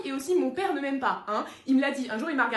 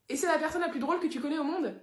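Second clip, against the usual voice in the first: ordinary talk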